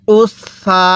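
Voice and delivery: উস সা, long held s across both syllables; doubled consonant. Shown as a demonstration. Teacher leading learners to read along, doubled s held long. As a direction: hold the s from উস into সা. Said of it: "আমি যেভাবে পড়ব আপনিও সেভাবে".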